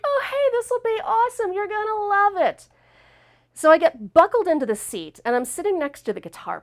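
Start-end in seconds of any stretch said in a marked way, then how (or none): 0.0-2.7 very high-pitched mock enthusiasm; sing-song high imitation of a cheery friend; gushing friend imitation. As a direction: chirp it like an overexcited friend. Imitating friend egging her on.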